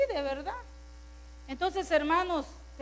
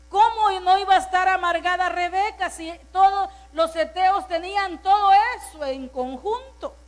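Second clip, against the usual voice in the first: impassioned rhetorical question; 4 semitones higher, 8 dB louder than usual. Acted. Rhetorical question at full force.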